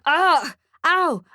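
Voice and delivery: high, loud pained yelp; yell of pain. A narrator's reading. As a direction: cry out as if your beard was yanked. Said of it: "Nicholas yells in pain".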